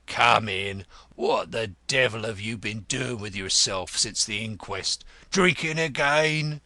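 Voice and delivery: blunt, growly ex-detective; rough, forceful scolding welcome; gruff Grodman, chiding. Acted. Grodman taking a tardy visitor to task, gruff.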